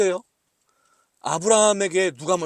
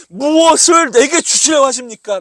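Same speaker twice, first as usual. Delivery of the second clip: loud demanding plea; 6 semitones higher, 12 dB louder, slower than usual. Acted. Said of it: "라고 구하고 있는 거죠".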